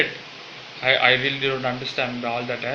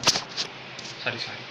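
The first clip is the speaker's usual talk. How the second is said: whispered sorry; 3 semitones lower, 7 dB quieter, much slower than usual. Real, not acted. Told off to hold the mic.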